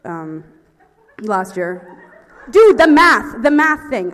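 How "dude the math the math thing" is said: loud, high outburst; exasperated. Real, not acted. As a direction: protest loudly at the very idea. Exasperated at doing math.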